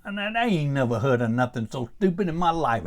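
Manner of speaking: normal talking voice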